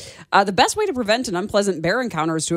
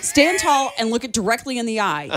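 normal talking voice

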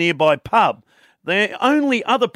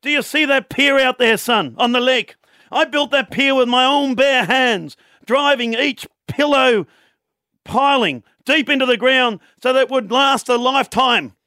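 put-on Irish accent; 7 semitones higher than usual. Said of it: "says in his thick Irish accent".

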